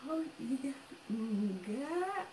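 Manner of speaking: soft, dainty, slowed reply; hushed, refined mock agreement; Esther. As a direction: imitate a delicate, restrained talker. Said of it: "kalau Esther kayak gitu".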